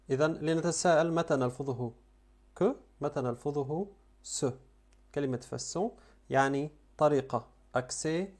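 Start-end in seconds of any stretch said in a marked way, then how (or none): none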